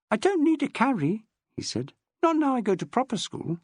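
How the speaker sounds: put-on proud little boy. Boy insisting.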